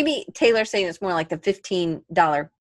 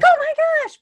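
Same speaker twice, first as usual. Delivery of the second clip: high, loud starstruck squeal; excited gasp; 6 dB louder, faster than usual. Retold or imitated. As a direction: squeal like a fan meeting a hero. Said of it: "I was so excited when I met Blake".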